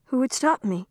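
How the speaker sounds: a hint of a curious young girl explaining; young girl Anna Marie. A narrator's reading.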